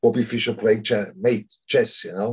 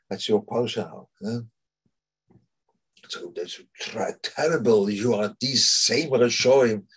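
ordinary talk

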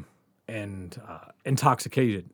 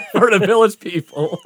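laughing; 6 semitones higher than usual. Real, not acted. Telling his friend about the army guy, laughing. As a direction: laugh your way through the punchline.

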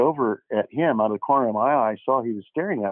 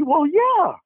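much higher, louder, bashful eager fan; 5 dB louder than usual. Retold or imitated. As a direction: play the thrilled fan who was caught off guard. Imitating a shy eager fan.

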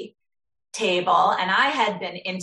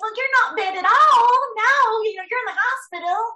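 put-on much higher, bright Southern nurse accent; 5 dB louder than usual. Cute nurse tells waking patient she's alive, Southern accent. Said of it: "she had a Southern accent".

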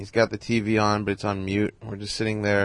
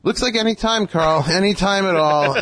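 mock eager reply; 9 semitones higher, 5 dB louder than usual. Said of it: "ruffling it around".

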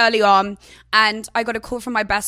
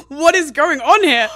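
louder, slow, incredulous question; amused disbelief; 3 semitones higher, 5 dB louder, slower than usual. Retold or imitated. Girlfriend reacting to crude homemade sweatshirt, incredulous. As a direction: draw out the question in comic disbelief. Laughing disbelief at the ex's gesture.

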